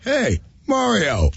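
high, slow, falling hello; drawn-out greeting. Retold or imitated. Comic retells greeting the drunk guy onstage, drawn-out high greeting.

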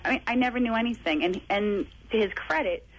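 ordinary talk

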